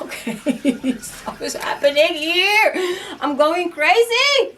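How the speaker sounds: laughing it off in half-panic, slowing down; a nervous laugh through the words; laughing disbelief. Retold or imitated. To herself after finding the keys in the snow, laughing in disbelief. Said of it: "I was like".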